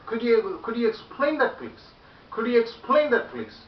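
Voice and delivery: high, loud, animated request with "explain" punched; lively teacher's model request; full of life. Shown as a demonstration. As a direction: hit "explain" high and loud, let "please" drop. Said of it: "come with the life".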